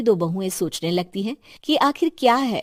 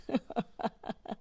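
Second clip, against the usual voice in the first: breathy chuckle; 12 dB quieter than usual. A narrator's reading. Husband laughs at greedy bahus.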